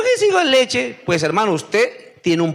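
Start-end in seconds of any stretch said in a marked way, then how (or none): 0.0-1.0 mock baby believer. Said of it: "Y llego yo y digo".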